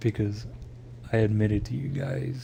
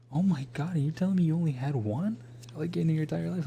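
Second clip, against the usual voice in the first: high-pitched imitation of a friend's disbelief; mock shock; 7 semitones higher than usual. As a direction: mimic someone appalled, voice pitched way up. Acted friend's shock over burritos.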